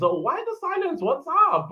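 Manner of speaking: mock impatient chat read. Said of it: "Emperador says".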